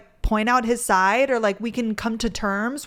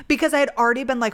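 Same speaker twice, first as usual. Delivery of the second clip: ordinary talk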